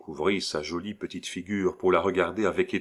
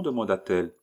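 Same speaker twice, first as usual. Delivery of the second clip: plain reading voice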